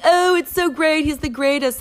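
high-pitched imitation of adoring fans; mock gushing. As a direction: gush in a high, put-on fan voice. Mimicking fans gushing over quarterback.

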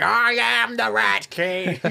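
slow, loud, high monster roar; monster king declaration. Acted. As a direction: boom it out as a monster. Blob king declares himself.

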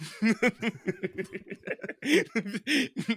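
high, long cackle; real laughter. Real, not acted. Co-host cracking up at the British bit, real high laugh. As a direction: crack up helplessly.

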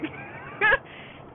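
high burst of laughter; cracking-up laugh. Retold or imitated. Grandpa sees the log instead of a fish, cracking up. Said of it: "he's cracking up".

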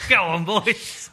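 high cheery team cheer; rallying cheer. Retold or imitated. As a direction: cheer the lads on. Mock rallying shout.

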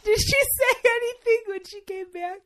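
high, loud, through laughter; real squealing amusement; laughing question. Real, not acted. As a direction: ask while cracking up. Still cracking up, asks.

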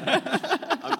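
high, loud burst of real laughter. Real, not acted. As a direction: crack up at the joke. Joke about the country of Maryland.

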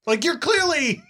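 very high, incredulous complaint; comic indignation climbing into a high pitch; angry protest. Retold or imitated. Losing player protests to the team-player friend, angry. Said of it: "I was so angry".

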